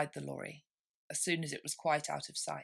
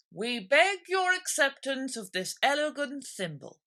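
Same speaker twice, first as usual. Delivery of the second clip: higher, louder, ceremonious; solemn dodo speech; 8 semitones higher, 6 dB louder than usual. A narrator's reading. Dodo presenting Alice the thimble, solemn and grand. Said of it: "solemnly presented the thimble".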